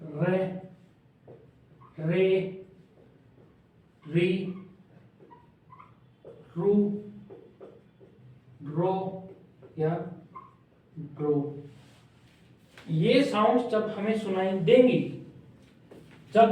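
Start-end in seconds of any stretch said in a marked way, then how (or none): 0.0-11.6 रे री रू रो spoken slowly, syllable by syllable; teacher drills रे री रू रो, pausing between each; demo syllables. Shown as a demonstration. Shorthand teacher listing रे री रू रो, slow drill. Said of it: "जब भी हमें".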